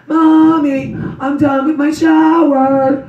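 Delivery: put-on high, slow child's call from upstairs; little daughter calling. Mother imitating her little daughter calling from the shower, high and slow. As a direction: call down like a small girl done bathing. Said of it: "she'd go".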